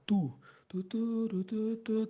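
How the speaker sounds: tu, tu, tu with a firm, fairly hard attack; tongue each "tu" crisply; articulated tu syllables. Shown as a demonstration. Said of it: "o tu, ele é um pouco mais intenso".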